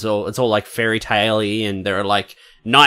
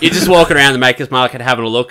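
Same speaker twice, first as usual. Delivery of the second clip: usual speaking voice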